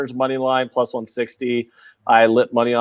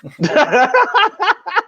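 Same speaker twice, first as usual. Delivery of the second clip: high laugh; 4 dB louder than usual. Real, not acted. Laughing at the Boston accent.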